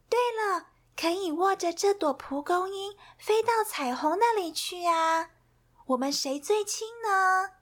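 high cheerful Millie voice; Millie's bright idea. Acted. Millie tells her friends her idea, high and eager.

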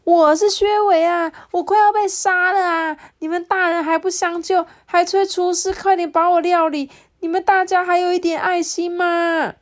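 拔高嗓子大声哭喊求救; 快被杀了的拼命哭叫; 绝望呼喊. A narrator's reading. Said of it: "这时候我一直喊着说". (薛维快被杀时向大人们高声哭喊求救)

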